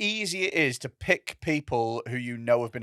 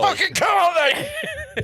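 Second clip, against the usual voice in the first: very high shouted mock yell; mock scream; 3 dB louder, much slower than usual. Friend joking about a man screaming, mock yell. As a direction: scream it in frustration. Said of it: "Just screams at his dick".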